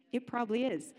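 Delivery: normal talking voice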